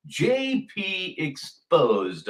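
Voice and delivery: "J P Exposed" drawn out slowly, each part spaced; slow title announcement. Shown as a demonstration. Host announcing tonight's feature title, slow and weighty. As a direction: unveil "J P Exposed" slowly like a headline. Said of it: "We are going to watch".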